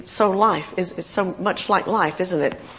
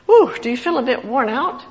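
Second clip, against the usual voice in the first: ordinary talk, nothing marked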